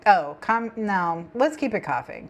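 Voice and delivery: ordinary talk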